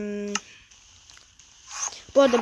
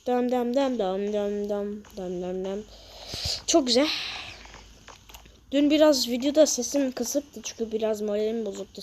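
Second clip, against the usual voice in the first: normal talking voice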